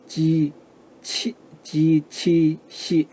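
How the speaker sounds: 지, 치, 시 drawn out slowly, syllable by syllable; pinyin initials. Shown as a demonstration. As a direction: spell out 지 치 시 slowly. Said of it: "요 세 가지 음이죠".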